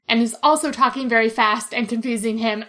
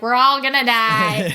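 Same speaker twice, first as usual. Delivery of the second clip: slow, louder grumbled doom line; grumpy old lady; 7 dB louder, slower than usual. Retold or imitated. Fan quoting the grumpy radio lady's doom line, slow and loud. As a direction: growl it out like a jaded old radio operator. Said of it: "She's the grumpy old lady".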